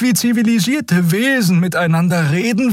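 high-pitched, measured character voice; calm peacemaker. Acted. Crewmate urging Keru to talk like civilised beings, high and measured. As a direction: reason with them in a high, even voice.

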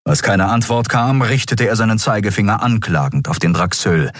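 normal talking voice

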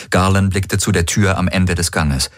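usual speaking voice

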